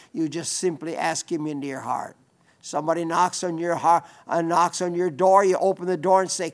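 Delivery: ordinary talk, nothing marked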